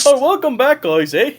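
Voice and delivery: put-on high, loud Canadian barkeep accent with a bouncy eh. Barkeep greeting the returning party, Canadian accent. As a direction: play the Canadian moose bartender accent. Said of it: "a Canadian accent".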